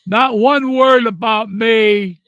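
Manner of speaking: slow, loud, resentful complaint; bitter outburst. Real, not acted. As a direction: spit it out, hurt and furious. Angry at the funeral comments.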